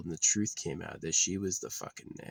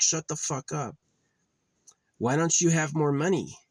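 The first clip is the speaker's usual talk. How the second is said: high, loud mock yell; imitating yelling mother; 8 semitones higher, 5 dB louder than usual. Mother yelling at her son about money, loud mock yell. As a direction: bark it at him like she did.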